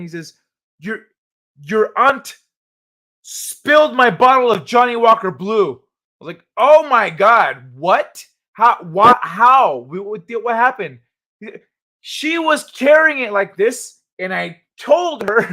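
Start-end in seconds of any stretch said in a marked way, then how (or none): 0.8-5.7 high, loud imitation of an angry grandpa; pissed-off grandpa. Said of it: "comes in all pissed off". 6.6-11.0 loud, high mock disbelief; acted shock. Acts shocked reply to grandpa. 12.0-15.4 high, indignant grandpa retelling; pissed-off grandpa. Retold or imitated. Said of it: "comes in all pissed off".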